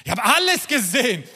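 high, emphatic exclamation; exasperated high-pitched declaration; emphatic Solomon. Acted. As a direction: repeat it insistently, pitched high. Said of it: "er sagte uns".

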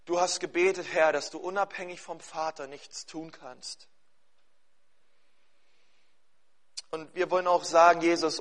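earnest congregational prayer, phrase by phrase; spoken prayer. Real, not acted. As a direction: pray sincerely on an even, contained pitch.

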